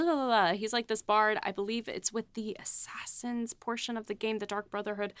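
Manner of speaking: ordinary talk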